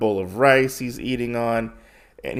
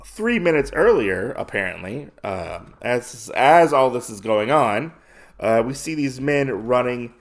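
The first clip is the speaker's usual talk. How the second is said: ordinary delivery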